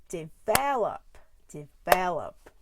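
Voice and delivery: develop, slowly, VEL drawn out; stress on second syllable. Shown as a demonstration. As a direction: punch and stretch the VEL in develop. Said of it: "um, the stress".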